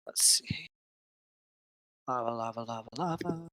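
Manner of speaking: very soft, absent-minded murmur; muttering to self. Real, not acted. Game master looks up lava damage, muttering to self. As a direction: murmur it under your breath while searching.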